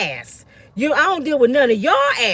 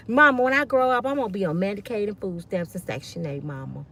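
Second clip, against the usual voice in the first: mock child wish; 6 semitones lower, 6 dB quieter than usual. Mock child dream to mama.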